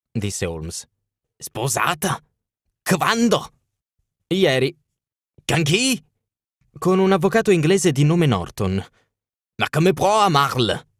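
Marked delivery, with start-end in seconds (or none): 1.4-10.9 higher, incredulous royal questions; a king stung by the news; shocked king. Acted. King hearing Irene has married, shocked and higher.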